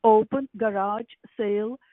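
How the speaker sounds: ordinary talk, nothing marked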